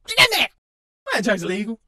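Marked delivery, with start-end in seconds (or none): 0.1-0.5 high, loud cheer; cheering shout. Acted. Fan cheering a player on, high shout. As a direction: yell it from the stands.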